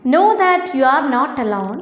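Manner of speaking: ordinary talk, nothing marked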